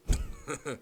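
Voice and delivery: unvoiced, airy chuckle; breathy laugh. Real, not acted. Breathy laugh before topic.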